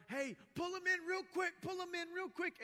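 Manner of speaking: high, loud frantic yell from the riverbank; retold shouting. Bystanders shouting to rescuers on the river, loud panicked yell. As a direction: yell it like panicked rafters pulling someone in. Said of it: "people screaming from the side".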